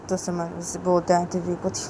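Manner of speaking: ordinary delivery, nothing marked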